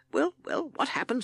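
impatient captain pressing for the story. Acted.

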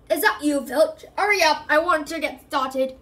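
loud impatient call; distant shout. A narrator's reading. Gamekeeper calls to caretaker across dark grounds, loud shout. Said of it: "they heard a distant shout".

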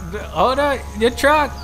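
sudden high, loud exclamation; surprised outburst. Real, not acted. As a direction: blurt it out in shock. Reacting to clip, sudden outburst.

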